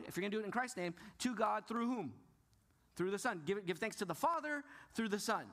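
ordinary talk, nothing marked

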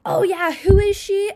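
high, loud fake gushing; mock hype, mean. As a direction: imitate mean girls pretending to hype someone up. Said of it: "these girls are like".

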